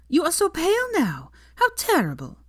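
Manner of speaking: high, bright foreign-sounding gush; exclaiming she looks pale; gushing Sophia voice. A narrator's reading. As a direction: gush over her like an old friend. Sophia greets Hermione effusively.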